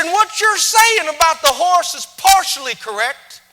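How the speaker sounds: high, loud proud cowboy boast; proud rancher. Retold or imitated. Texan rancher boasting to the speaker, high and loud. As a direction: boast about the ranch life loudly. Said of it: "awesome Texan rich accent that I wish I could capture".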